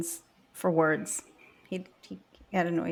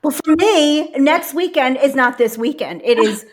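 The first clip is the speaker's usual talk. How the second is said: louder, insisting; emphatic; 5 semitones higher, 9 dB louder than usual. Retold or imitated. Arguing with husband over which weekend, insistent. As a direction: press the point hard. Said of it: "I'm like".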